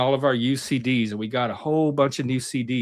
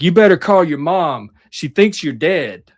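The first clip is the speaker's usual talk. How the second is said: loud stern warning; stern cop; 4 semitones higher, 7 dB louder than usual. Retold or imitated. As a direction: warn him like a stern cop. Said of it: "He's like".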